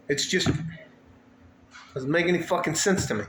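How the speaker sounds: usual speaking voice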